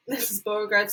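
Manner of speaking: usual speaking voice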